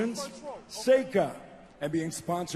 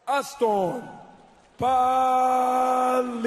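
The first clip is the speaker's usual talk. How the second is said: long drawn-out winner call, higher and louder; lucha libre announcer; 5 semitones higher, 4 dB louder, much slower than usual. Real, not acted. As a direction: stretch the name out like a ring announcer. Announcer calls out winner's name.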